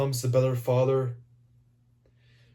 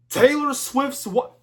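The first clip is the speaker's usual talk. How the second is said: high, loud shocked outburst; shocked question; 12 semitones higher, 5 dB louder, slower than usual. Acted. Reviewer reacting to [name]'s mention, high loud shock. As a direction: blurt it out in high-pitched disbelief.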